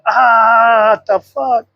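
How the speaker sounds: high, drawn-out mock cry; mock horrified reaction. Himself at the mirror like those videos, mock horror. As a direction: imitate a shocked video reaction. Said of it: "it's like those real videos".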